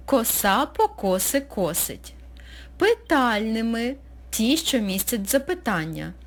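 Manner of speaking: usual speaking voice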